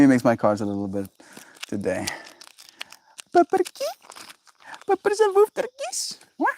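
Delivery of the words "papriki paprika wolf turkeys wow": squeaky high pet-talk voice. Real, not acted. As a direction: coo at the puppy way up high. Cooing at the dog.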